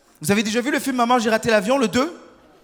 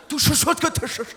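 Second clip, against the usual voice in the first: higher, shushing mock Mandarin gibberish; high imitation Mandarin, all hushing sounds; mock Mandarin, secretive; 7 semitones higher than usual. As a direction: trade secrets in pretend Mandarin. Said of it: "ils sont dans le secret".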